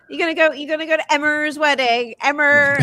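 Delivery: playful jibe, "Emma" ending in "err"; mocking R ending. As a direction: imitate a teaser putting a hard R on "Emma". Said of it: "John made fun of me for years".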